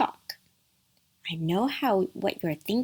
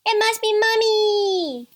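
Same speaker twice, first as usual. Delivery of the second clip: joyful cry; 13 semitones higher, 7 dB louder than usual. A narrator's reading. Little kids think mother is home, crying out. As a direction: cry out together in excitement. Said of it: "they all cried".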